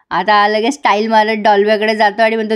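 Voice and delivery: ordinary delivery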